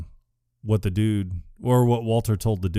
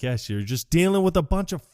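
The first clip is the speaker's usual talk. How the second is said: mock scorn; 8 semitones higher than usual. Walter scorns the kidnappers.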